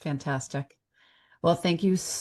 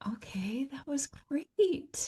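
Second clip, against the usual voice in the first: whispering; 9 semitones higher, 7 dB quieter, slower than usual. Real, not acted. Off-air aside after recording.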